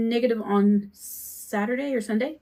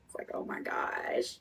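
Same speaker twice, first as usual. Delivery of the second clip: overwhelmed whisper; 13 dB quieter, slower than usual. Real, not acted. Overwhelmed by son's pain.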